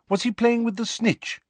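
high-pitched, keen question; eager. A narrator's reading. Lupin reminisces about James, eagerly. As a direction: ask eagerly, pitched right up. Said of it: "said Lupin eagerly".

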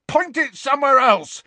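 very high, loud alarm; frightened, high-pitched demand; panicky shout. A narrator's reading. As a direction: yell it in panic. Dudley panics at the wand.

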